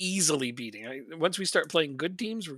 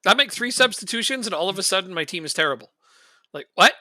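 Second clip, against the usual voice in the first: louder and higher, venting frustration at the game; exasperated outburst; 4 semitones higher, 8 dB louder than usual. Real, not acted. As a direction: throw up your hands and rant at "I make three substitutions". Frustrated rant about substitutions.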